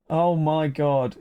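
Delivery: usual speaking voice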